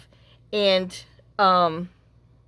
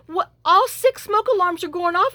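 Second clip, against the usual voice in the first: frantic report; 10 semitones higher, 3 dB louder than usual. Retold or imitated. Acted frantic phone call to son.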